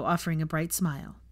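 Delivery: ordinary delivery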